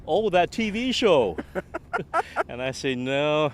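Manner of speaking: ordinary delivery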